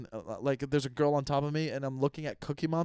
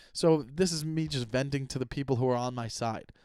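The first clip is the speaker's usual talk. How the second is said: ordinary talk, nothing marked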